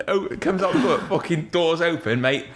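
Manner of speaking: ordinary talk, nothing marked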